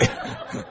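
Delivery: short breathy laugh; real chuckle. Real, not acted. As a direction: chuckle quietly to yourself. Reader laughs at the interruption.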